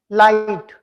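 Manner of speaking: "Light" with the tongue tip on the ridge behind the top teeth; light, clear L. Shown as a demonstration. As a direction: say "Light" with a crisp L. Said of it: "See what happens when you pronounce that word light".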